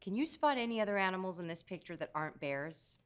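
ordinary delivery

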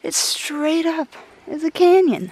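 high, rising amazement at the canyon; amazed. Real, not acted.

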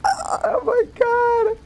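awed. Real, not acted.

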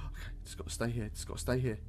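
hushed and tense while hiding; quiet voice. Real, not acted. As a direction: keep it down so he won't hear. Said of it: "Sorry, guys, if I'm, like, quiet".

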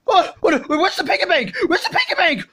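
high, frantic wailing; terrified cry of discovery; panicked. Acted. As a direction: wake with a yelp and panic, pitched high. Waking to find piggy bank gone.

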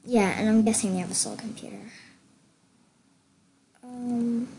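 ordinary talk, nothing marked

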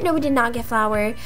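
usual speaking voice

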